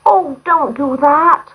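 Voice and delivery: put-on girl crying out to save her friend. A narrator's reading. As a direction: beg them to stop. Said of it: "cried the robber's daughter".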